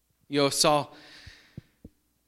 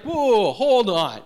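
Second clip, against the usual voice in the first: startled priest; 8 semitones higher, 8 dB louder than usual. Retold or imitated.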